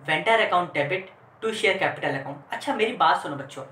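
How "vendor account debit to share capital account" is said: very slow, each word separate; teacher reading out an entry slowly; slow dictation. Shown as a demonstration. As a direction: dictate the entry for the class to copy. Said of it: "par पे हम entry करेंगे".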